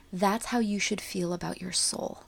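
normal talking voice

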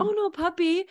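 put-on high little-kid concern; imitated young daughter. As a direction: imitate a child fussing over her puppy. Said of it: "And she's like".

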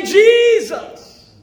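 high, loud cry of Jesus; urgent cry. Shown as a demonstration. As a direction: call out Jesus in desperate need. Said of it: "you only have time to say".

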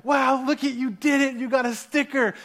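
high-pitched imitation of a gushing mother; impressed praise. Wife greeting her daughter off the ride, retold delight.

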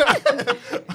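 bursting into a high laugh; high loud laugh. Real, not acted. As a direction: laugh it off loudly. Laughing at his own accent.

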